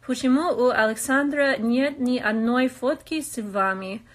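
Russian read aloud with a strong American accent. Real, not acted. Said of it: "у меня есть большой акцент".

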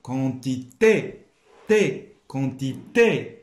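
teacher modelling Quantité slowly; slow careful pronunciation. Shown as a demonstration. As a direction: spell out Quantité by ear, slowly. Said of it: "زدنا l'accent".

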